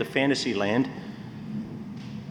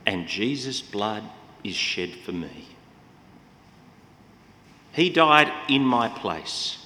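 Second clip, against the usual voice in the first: ordinary delivery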